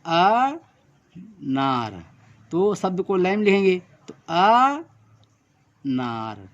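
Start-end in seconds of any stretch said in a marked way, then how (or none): 0.1-2.0 slow demo. Shown as a demonstration. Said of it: "आ का उच्चारण हो रहा है". 3.8-6.5 अनार stretched very slowly, each sound held; dictation-style slow अनार; drawn out word. Shown as a demonstration. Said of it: "ये आ का मात्रा है".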